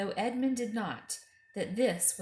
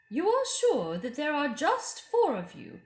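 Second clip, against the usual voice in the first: high, louder probing queen voice; suspicious Queen; 7 semitones higher, 7 dB louder than usual. A narrator's reading. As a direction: press him like a queen who suspects. The Queen's voice in narration.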